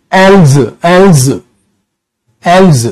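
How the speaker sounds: "एल्स" ending in a voiced z, like elz; else's with a z. Shown as a demonstration. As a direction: repeat "एल्स" with a buzzing z at the end, not s. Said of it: "उन्होंने इसकी pronunciation ही बदल दी".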